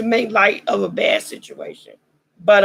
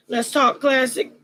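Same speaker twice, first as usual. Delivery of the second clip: usual speaking voice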